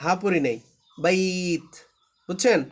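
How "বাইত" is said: "বাইত" with a long ai, ending on t; sustained recitation-style "বাইত"; long ta kept as t. Shown as a demonstration. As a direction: hold the ai of "বাইত", close on t, not h. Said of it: "এখানে কিন্তু আমরা তাই পড়ছি".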